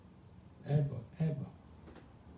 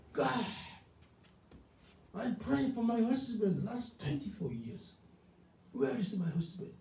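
angry complaint to God; 9 semitones higher than usual. Retold or imitated. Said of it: "She's so angry with God".

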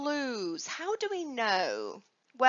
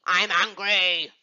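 angry; 7 semitones lower, 5 dB louder than usual. Shown as a demonstration. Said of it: "Give me angry".

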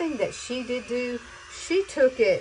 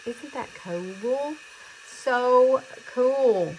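delighted; 5 semitones higher, 5 dB louder, much slower than usual. Real, not acted. Delighted at curls.

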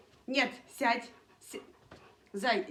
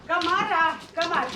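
calling out; 4 semitones higher, 10 dB louder than usual. Real, not acted. Owner summoning her dog Камара, calling out loudly. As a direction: holler for the dog.

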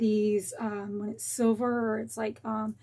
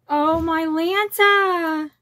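louder, slow, drawn-out delight; excited gush; 4 semitones higher, 8 dB louder, much slower than usual. Real, not acted. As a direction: savour every word in joy. Overwhelmed by the bag.

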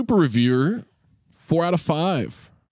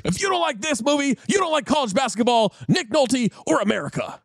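higher, punchy sports announcer call; Kings announcer; 7 semitones higher than usual. Shown as a demonstration. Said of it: "You gotta say that like the Kings announcer".

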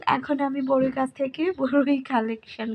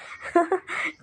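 short, higher-pitched chuckle; light chuckle; 6 semitones higher, 3 dB quieter than usual. Real, not acted. Vlogger amused at the nephew picking fruit too, light chuckle. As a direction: let out a brief amused laugh.

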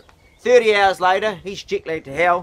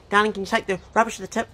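quick, high nagging wife voice; pitched-up, hurried mock nag; 5 semitones higher, faster than usual. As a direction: rattle off a chore in a mock wifely pitch. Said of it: "And nag, nag".

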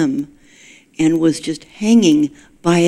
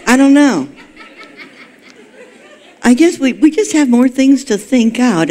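high, louder laughing through the words; 7 semitones higher, 3 dB louder than usual. Real, not acted. Laughing at the turkey irony while telling the congregation. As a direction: chuckle it out, amused at yourself.

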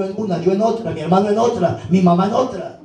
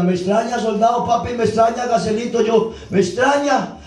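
higher, sing-song street slang reply; street thief voice; 5 semitones higher than usual. Retold or imitated. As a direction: play the thief brushing him off. Thief answers in retold street scene.

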